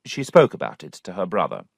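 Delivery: usual speaking voice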